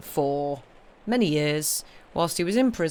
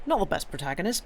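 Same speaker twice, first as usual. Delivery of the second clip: ordinary talk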